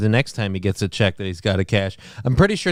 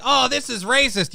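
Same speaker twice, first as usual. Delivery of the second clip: mock whiny kid; 12 semitones higher than usual. Mocking the kid's protest.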